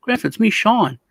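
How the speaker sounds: high, earnest pleading; mock pleading grandson. Grandson pleads with grandpa to recognise him, high voice. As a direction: insist gently that it's really you. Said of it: "I was like".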